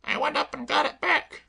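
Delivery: high, chirpy cockney maid voice; perky maid reassuring her mistress; Claire the maid. Acted. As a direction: pitch her up and pert.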